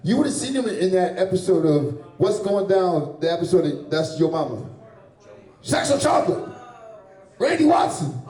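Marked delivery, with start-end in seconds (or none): 5.6-8.2 high, punchy hype cry; film stage announcement. Acted. Comic jogging crowd's memory of a film, mock stage announcement. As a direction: call out the band like an emcee.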